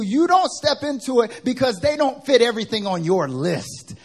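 high, loud sermon-like tirade; imitated angry preaching. Worship pastor berating sisters about their lists, loud preaching rant. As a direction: act out an upset pastor preaching hard at them. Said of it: "John is preaching".

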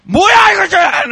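explosive shout, high and loud; furious Korean yell. Retold or imitated. Old Korean man snapping at the young driver, roaring. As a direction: bark it like a man who just snapped. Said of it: "he starts cursing up".

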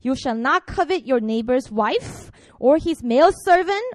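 ordinary delivery

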